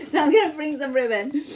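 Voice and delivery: laughing through the words; amused laugh spilling into the line. Real, not acted. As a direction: chuckle at her own mistake while speaking. Laughs at own oversight.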